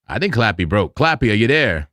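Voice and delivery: normal talking voice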